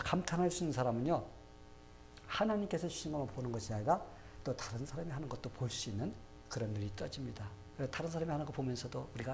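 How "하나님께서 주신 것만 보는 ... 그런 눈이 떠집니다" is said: normal talking voice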